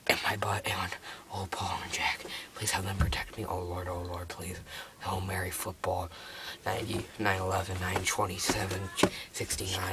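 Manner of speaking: low, very soft rambling mock prayer; hushed praying. Player hiding from the seeker, hushed rambling prayer. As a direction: babble a prayer under your breath.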